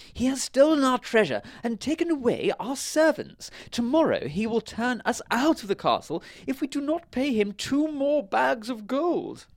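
very high, loud king voice; story king. A narrator's reading. King tells the wizard of the baron's threat, high loud complaint. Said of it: "said the king".

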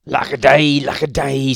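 loud, theatrical lament; sadly. A narrator's reading. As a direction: wail it as a woeful husband. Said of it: "said Mister Greenling sadly".

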